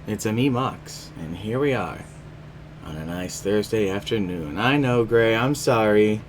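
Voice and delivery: usual speaking voice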